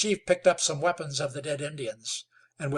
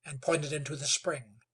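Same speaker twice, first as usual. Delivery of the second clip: usual speaking voice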